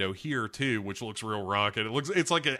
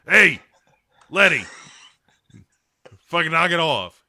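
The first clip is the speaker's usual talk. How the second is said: loud, higher mock telling-off; mock yell; 6 semitones higher, 11 dB louder than usual. Ghost scolding Letty to get to work, loud mock yell. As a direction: bark at her like an exasperated ghost. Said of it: "she comes in and she's like".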